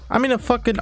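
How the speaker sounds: panicked outburst. Real, not acted. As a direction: blurt it in a panic. Panicked mid-fight outburst.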